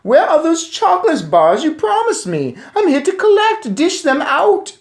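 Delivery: put-on very high, loud demanding boy; greedy demanding boy; bratty boy. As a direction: whine it like a greedy brat.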